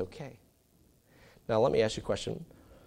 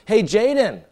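calling out; 11 semitones higher, 12 dB louder, slower than usual. Retold or imitated. Son calls brother.

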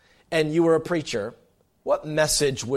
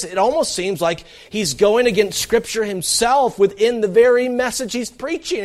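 ordinary talk, nothing marked

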